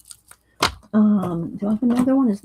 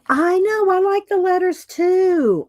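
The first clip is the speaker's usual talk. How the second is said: bright reply to chat; 11 semitones higher, 4 dB louder than usual. Real, not acted. Answering chat, pitched up.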